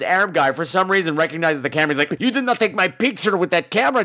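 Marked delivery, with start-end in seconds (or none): 2.2-4.0 mock Spanish-accented warning; crisp, accusing Spanish-accented English. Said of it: "I don't know why he's Spanish now".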